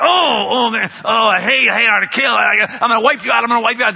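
anger. Acted. God to unfaithful people, furious jealous shouting. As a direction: rage like a betrayed lover. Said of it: "There's this anger, it's like".

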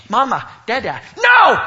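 put-on high shouted toddler words ending in no; toddler sleep talk. Dad imitating daughter sleep-talking, shouting. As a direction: call out the names then yell no. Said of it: "talking in her sleep".

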